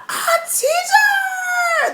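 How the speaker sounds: a high squealed cry of teacher; excited shout. Retold or imitated. Kids spotting her new hair, excited squealing shout.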